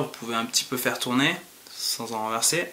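talking while sniffing glass; speaking into a glass. Real, not acted. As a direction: mouth behind glass rim. Seen 0: Glass rim raised over mouth to nose while speaking, sniffing aroma.

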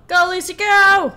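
high, very loud rooting yell; full-volume, high-pitched urging on; shouted cheer. Pretend. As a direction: yell it like a fan at the finish line. Cheering on a game character.

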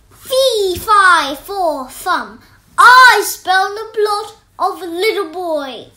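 loud booming giant chant; giant shouting. Acted. The greedy giant shouting as he enters the kitchen. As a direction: boom it out like a hungry giant. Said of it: "the giant shouted".

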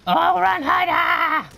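very high, loud yell at a fleeing player; hollering with glee; gleeful shout. Real, not acted. Gamer shouting at a fleeing enemy, gleeful and loud.

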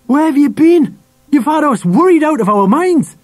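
high, loud angry outburst. A narrator's reading. Father scolds son who vanished, loud and angry. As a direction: demand an answer, pitched right up. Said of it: "he said angrily".

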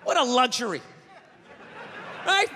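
ordinary talk, nothing marked

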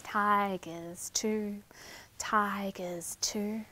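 soft, low whisper before the roar; whispered suspense. Shown as a demonstration. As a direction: hush them before the pounce.

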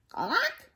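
high imitated chicken squawk; quick rising squawk; chicken cluck. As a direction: cluck like a storybook hen. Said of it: "said a chicken".

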